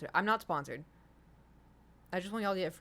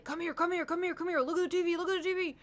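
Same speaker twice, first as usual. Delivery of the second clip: dad calling excitedly; 10 semitones higher, 4 dB louder than usual. Retold or imitated.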